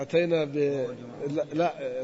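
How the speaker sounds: ordinary delivery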